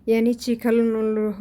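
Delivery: ordinary talk, nothing marked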